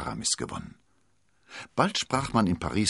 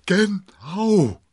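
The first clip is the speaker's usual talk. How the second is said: loud, high, exhausted exclamation; theatrical relieved groan; sighing landlord; 14 semitones higher, 9 dB louder than usual. A narrator's reading. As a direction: heave it out as a big sigh. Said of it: "seufzte Bonacieux".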